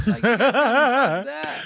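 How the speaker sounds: laughing. Real, not acted. Retelling cops accusing him, laughing.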